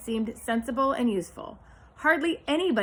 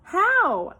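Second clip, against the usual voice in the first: high, loud sudden question; eager question; 10 semitones higher, 7 dB louder than usual. A narrator's reading. Pig asks Charlotte how, high and loud. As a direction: ask it in urgent hope. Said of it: "asked Wilbur".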